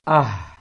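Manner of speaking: "Ah" loud with a breathy h from low in the throat. Shown as a demonstration. Teacher demonstrating round ha, loud. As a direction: breathe the h out from deep down. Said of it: "Seperti baca ha bahasa Indonesia".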